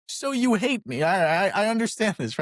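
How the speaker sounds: high-pitched, put-on wounded voice; mock hurt. Mom reacting to plastic flowers, mock hurt. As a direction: act hammed-up offence, pitched well up.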